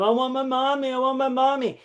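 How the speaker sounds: put-on high, whining little-kid voice; imitating a crying boy begging for mommy; whiny crying boy. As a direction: mimic a toddler wailing for his mom. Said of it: "this little boy was just crying all the time".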